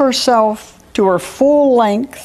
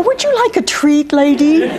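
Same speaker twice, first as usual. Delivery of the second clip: very high, sugary offer; polite coaxing; 6 semitones higher than usual. Acted. Maureen offering the bird a treat, sweetly. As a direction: offer it in a high, ingratiating lilt. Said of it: "the conversation between them began to change".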